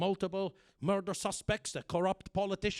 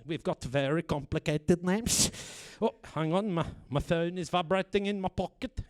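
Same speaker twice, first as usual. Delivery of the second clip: normal talking voice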